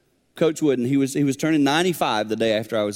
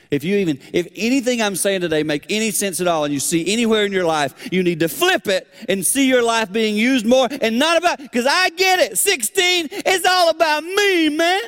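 much higher and louder, fervent; preaching at full force; 10 semitones higher, 4 dB louder than usual. Real, not acted. Preacher urging teens, higher and louder.

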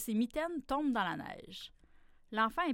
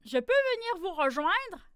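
higher, louder hopeful question; eager fox asking; 8 semitones higher, 6 dB louder than usual. A narrator's reading. Fox begging to join the animals, higher and louder. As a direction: ask brightly to be let in.

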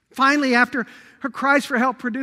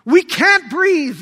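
slow and loud, a people crying for air; anguished cry; 5 semitones higher, 8 dB louder, slower than usual. Retold or imitated. Israel crying out to God, a slow loud anguished plea. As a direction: cry it out slow, straining for breath. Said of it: "they cried out to God".

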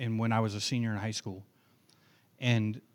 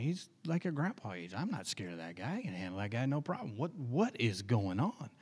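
much softer, sizing him up under his breath; quiet inner voice; 10 dB quieter than usual. Retold or imitated. Inner thoughts sizing up old man.

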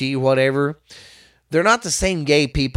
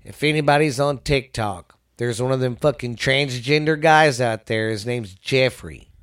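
ordinary talk, nothing marked

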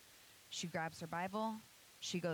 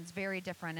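ordinary talk

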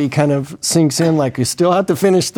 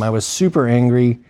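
ordinary talk, nothing marked

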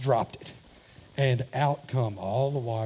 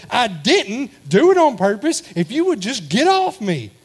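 snapping back; 8 semitones higher, 8 dB louder than usual. Retold or imitated. Husband fed up with his wife's nagging, loud snap. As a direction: bite back at her, fed up. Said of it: "in the tone that you just said it".

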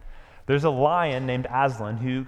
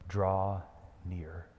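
soft invitation; 5 semitones lower, 6 dB quieter than usual. Shown as a demonstration. Preacher echoing Aslan's call, soft. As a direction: lower and much softer, drawn out. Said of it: "those are Jesus' words to you".

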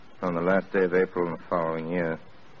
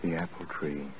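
hushed regret; 4 semitones lower, 11 dB quieter than usual. Acted. Frank's quiet regret.

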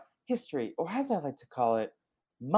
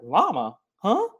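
"Huh" jumps sharply higher and louder; baffled surprise; 8 semitones higher, 6 dB louder, slower than usual. Retold or imitated. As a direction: squeak out a confused huh. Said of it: "And Marnie's like".

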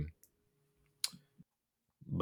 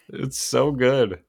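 ordinary delivery

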